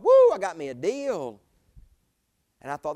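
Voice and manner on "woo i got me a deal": excited whoop. Retold or imitated.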